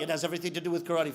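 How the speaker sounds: low, soft, fast rebuttal; unmoved master, quick and low; sensei. Acted. Sensei contradicting his student, low quick reply.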